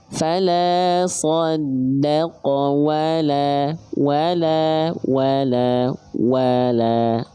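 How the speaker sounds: wala chanted again and again, lips pushed forward; Quran recitation. Shown as a demonstration. Teacher drills students on the rounded wau. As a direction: repeat wala with rounded lips each time. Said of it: "wa, muncungkan dulu".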